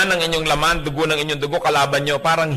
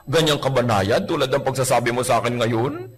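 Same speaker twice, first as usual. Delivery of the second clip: usual speaking voice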